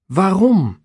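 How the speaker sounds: a high, loud pleading cry from Pilatus. Acted. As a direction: beg the crowd to reconsider. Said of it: "Pilatus pleit". (hoge, luide smekende uitroep)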